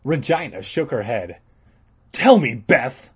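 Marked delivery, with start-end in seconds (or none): 2.1-3.1 louder insistent demand; insisting. Acted. Todd insisting at Beth, loud demand. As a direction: press her hard. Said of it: "Todd insisted".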